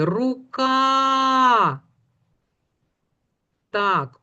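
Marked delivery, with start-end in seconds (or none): 0.0-1.8 рука with a very long, high final А; last sound drawn out. Shown as a demonstration. Teacher pointing out the last sound, vowel stretched. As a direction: hold the А ending рука. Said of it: "останній звук в слові".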